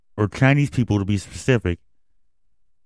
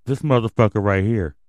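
ordinary talk, nothing marked

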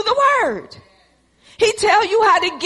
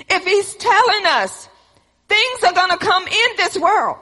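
preaching; 3 semitones higher than usual. Acted. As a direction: preach it with building force. Preaching at full force.

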